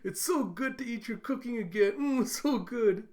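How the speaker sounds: high-pitched gushing praise; hammed-up relish. Yuri praises Yor's cooking, mock delight. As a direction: gush as if it were delicious. Acted praise while being sick.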